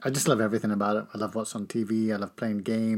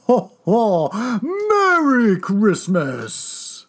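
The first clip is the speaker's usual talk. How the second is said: loud, high Santa laugh and greeting; jolly ho ho ho; 9 semitones higher, 8 dB louder, much slower than usual. Acted. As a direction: boom it out like Santa. Dad plays Santa greeting.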